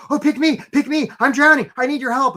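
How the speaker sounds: needy applicant. Retold or imitated. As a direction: beg to be picked. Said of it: "be like".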